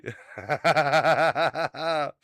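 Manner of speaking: loud burst of real laughter. Real, not acted. Cracking up after his own bad impression.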